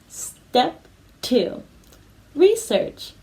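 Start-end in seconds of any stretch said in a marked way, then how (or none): 2.3-3.1 research said high and bright to copy; high falling research, teacher model; clear model word. Shown as a demonstration. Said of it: "Can you say research?".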